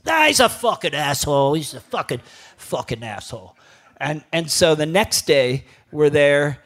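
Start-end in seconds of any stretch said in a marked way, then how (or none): 0.1-3.6 a touch of a gruff father, fading off; lightly voiced dad dismissing the rival; blunt Hungarian dad. Dad telling son the rival is an asshole, blunt. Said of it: "he's like".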